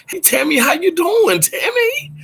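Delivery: bright high call out to a friend; excited greeting. Real, not acted. Host welcoming a viewer to the live, high excited hello. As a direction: sing out the name, delighted.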